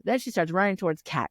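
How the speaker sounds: ordinary delivery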